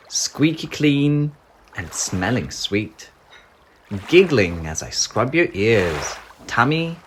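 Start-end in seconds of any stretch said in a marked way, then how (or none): none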